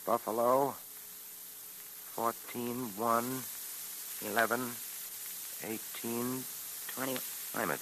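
quiet, absorbed working-out; muttering to himself. Acted. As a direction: mutter the numbers while decoding. Decoding the message to himself.

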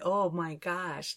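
normal talking voice

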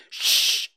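sharp loud hissed shh; urgent shush. Acted. Tom silencing Huck at a noise, urgent shush. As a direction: hush him at once. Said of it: "seized his comrade's arm and said".

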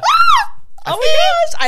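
high, loud excited outburst; gushing high-pitched shriek of excitement; thrilled squeal. Real, not acted. Thrilled that her taste was researched.

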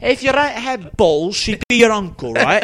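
put-on high, loud, drawn-out British accent; hammed-up Brit delivering a proverb slowly; British man impression. Remembering the video's line, loud British impression. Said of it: "That's, like, prime Brit".

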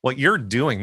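normal talking voice

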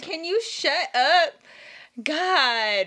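high, loud, drawn-out mock exasperation; exasperated. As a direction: act fed up with someone rambling on. Acted exasperation at rambling cousin.

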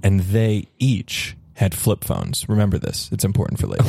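slow, low ominous narration; campfire storytelling. Acted. Boyfriend telling friends a scary campfire story, ominous. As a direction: set up a scary tale, measured and low.

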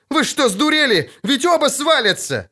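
high, outraged shout; крик. A narrator's reading. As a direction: shout at them in alarm. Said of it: "кричал Лютик". (возмущённо кричать высоким голосом)

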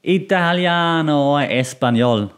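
slow, musical Italian-Spanish flourish; melodic Italian and Spanish. Shown as a demonstration. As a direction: savour it in a lilting Italian and Spanish accent. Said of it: "less melodic than".

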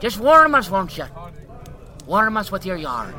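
loud cheerful heckling; jovial calling out. Acted. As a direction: call across the pub merrily. Patron calls out.

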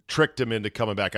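ordinary delivery

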